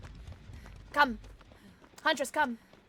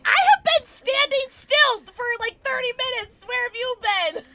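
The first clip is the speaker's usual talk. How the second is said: exasperated yelling; 11 semitones higher, 8 dB louder than usual. Real, not acted. Player waited thirty minutes for help, exasperated yelling. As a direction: yell it out, easing slightly by the end. Scolds teammates for not healing.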